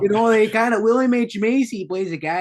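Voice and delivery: put-on a lightly held Fargo-style North Dakota accent. Host keeps a North Dakota accent while explaining Fargo. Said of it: "he talks like this".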